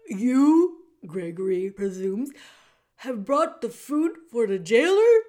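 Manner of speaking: put-on loud, booming old jailer who speaks of himself by name; the jailer Gregory greeting Meg in a big theatrical voice. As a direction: boom it out like a grand old jailer.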